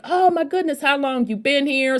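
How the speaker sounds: high, surprised gush; startled delight, voice jumping up high; shocked. Retold or imitated. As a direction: gasp it out like you can't believe it. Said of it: "I was shocked".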